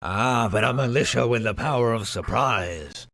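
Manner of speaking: ordinary talk, nothing marked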